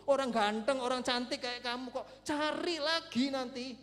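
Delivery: loud, stretched-out retold advice; brash encouragement. Counselor urges dumped teen to look again, loud and breezy. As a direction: call it out loudly, drawing out the first words.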